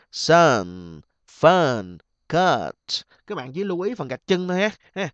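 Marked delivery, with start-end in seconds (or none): 0.1-2.7 "Sun, fun, cut" one at a time, loud open uh; mũ vowel. Shown as a demonstration. Teacher repeating options for students, emphatic mũ vowel. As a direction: punch out "Sun, fun, cut" with falling tune. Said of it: "đều là âm mũ".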